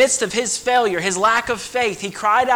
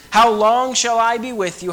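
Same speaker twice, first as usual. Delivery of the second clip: raised and louder, a grieving cry; Christ's anguished rebuke; 5 semitones higher, 6 dB louder than usual. Acted. Christ rebuking the faithless generation, a raised anguished cry.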